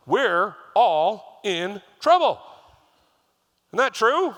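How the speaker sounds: loud, high-pitched preaching, pressing the point; preaching at full force. Acted. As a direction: urge the congregation not to get up, slowing on the warning. Preacher urging congregation to stay.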